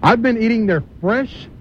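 ordinary delivery

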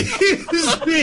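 high, slow laughing mock croak; hammed-up burp imitation; mock burp excuse. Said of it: "I'm sorry".

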